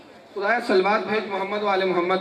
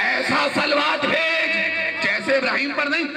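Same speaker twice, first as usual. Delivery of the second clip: fiery preaching; 8 semitones higher than usual. Real, not acted. Preacher proclaiming the meaning to the congregation, fiery raised preaching. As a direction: drive the point home to the crowd.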